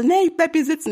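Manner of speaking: mocking the man. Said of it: "Und er so".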